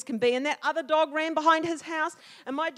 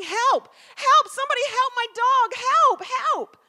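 high, loud panicked cries; yelling for help; 12 semitones higher, 11 dB louder than usual. Retold or imitated. Child calls help as dog is pinned, yelling. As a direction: scream for someone to come, pitched way up. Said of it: "So I started yelling".

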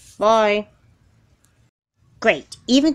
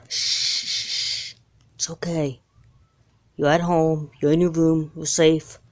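soft, lower comforting voice; calm her after the nightmare; soothing reassurance; 4 semitones lower, 3 dB quieter, slower than usual. Acted.

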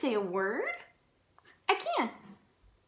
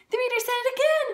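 much higher, loud excited cry; delighted cry; 10 semitones higher, 6 dB louder, faster than usual. Acted. Piggy thrilled the reader said it again, high and loud. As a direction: burst out with glee.

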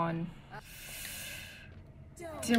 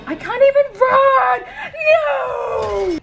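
high, loud desperate cry; real panicked shout; panicked yell; 11 semitones higher, 9 dB louder, slower than usual. Real, not acted. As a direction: scream in frustration. Trapped while fleeing.

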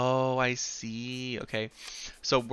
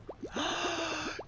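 long, high intake of breath; excited gasp; 7 dB quieter than usual. Real, not acted. Player spots a possible companion, excited gasp. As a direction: gasp in delighted surprise.

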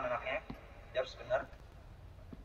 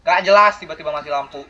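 loud complaint; 2 semitones higher, 13 dB louder than usual. Real, not acted. Player complains as the lights suddenly go out, loudly. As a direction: exclaim it much louder than usual. Protests sudden blackout in game.

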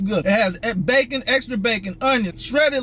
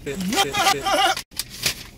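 high laugh; 6 semitones higher, 3 dB quieter than usual. Real, not acted. Laugh after wrap-up.

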